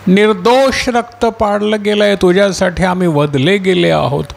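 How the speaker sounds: martyrs' appeal, pitched high and sustained; crying out. Acted. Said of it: "मोठ्यानं ओरडून काय म्हणाले".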